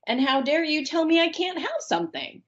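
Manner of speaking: pitched high, heated challenge; how dare you, rising in fury; indignant outrage. Real, not acted. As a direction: fling the question back in outrage. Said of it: "I just got so infuriated".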